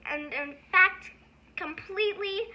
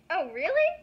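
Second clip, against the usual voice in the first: mock surprised; 6 dB louder than usual.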